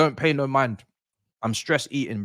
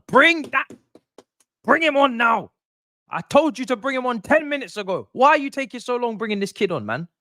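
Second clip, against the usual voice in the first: raised, high-pitched impatient yelling; exasperated demand; 5 semitones higher than usual. Real, not acted. Yelling at the coach for substitution.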